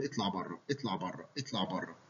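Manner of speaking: soft, low mechanical repeating; echoing the words over and over quietly; echolalic repetition. Retold or imitated. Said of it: "طلعت بره تقول".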